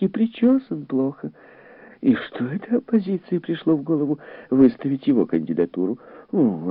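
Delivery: high-pitched, animated lady voices; lofty chatter about politics, pitched well up; gossiping society women. A narrator's reading. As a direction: gossip with relish in a high female voice.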